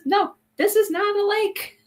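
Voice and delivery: high, slow imitated refusal; mock insistence. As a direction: draw it out high, playing his flat-out disbelief. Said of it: "he says".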